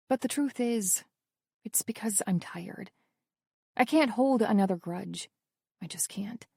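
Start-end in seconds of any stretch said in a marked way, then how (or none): 1.6-2.9 tired whisper, barely voiced. Weary inner confession. 5.8-6.5 soft, breathy whisper, fading out. Acted. Weary inner confession.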